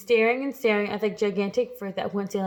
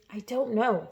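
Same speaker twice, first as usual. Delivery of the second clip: ordinary delivery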